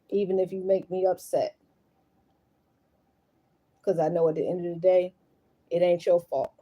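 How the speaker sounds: low, soft soothing voice; tender reassurance. Real, not acted. Mother promising to always be there, tender and hushed. Soothing own child after crying.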